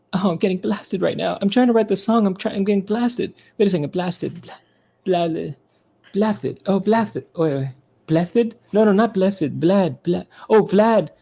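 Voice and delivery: high, put-on pop star voice. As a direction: puzzle over a lyric in a high mock voice. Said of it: "So he goes like this".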